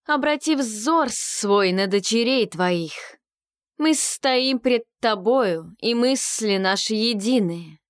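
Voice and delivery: slow, hypnotic priestess invocation; hypnotic enveloping voice. Acted. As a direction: draw them in, slow and enveloping. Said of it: "гипнотическим и обволакивающим голосом продолжала она".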